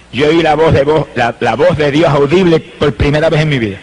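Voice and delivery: ordinary talk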